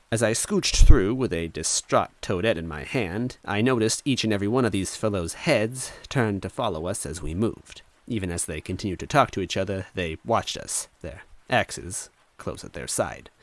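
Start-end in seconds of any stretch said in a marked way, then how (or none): none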